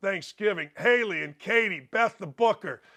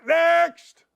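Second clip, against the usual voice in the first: higher, louder shout of Next; loud call; 8 semitones higher, 6 dB louder than usual. Real, not acted. Host calling the next joke, loud shout.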